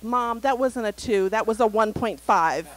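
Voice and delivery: mock child bargaining with mom; son bargaining. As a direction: drag it out like a kid arguing his score. Son haggling over chart score.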